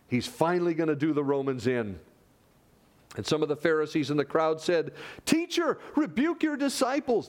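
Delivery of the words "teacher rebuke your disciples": high, alarmed protest; anxious Pharisee. Retold or imitated. Pharisee in the crowd urging Jesus, high and alarmed. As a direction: plead like a nervous Pharisee. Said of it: "They're afraid".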